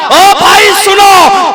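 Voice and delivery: the husband calls after the fleeing guest, loud and drawn out; calling out. Retold or imitated. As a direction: hail someone walking away. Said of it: "इसने आवाज दिया".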